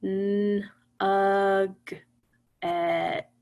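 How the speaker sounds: nugget sounded out as N, uh, g, et, evenly spaced. Shown as a demonstration. Teacher counting five sounds for the class, segmented. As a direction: separate each sound of N, uh, g, et on a level pitch. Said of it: "I hear five sounds".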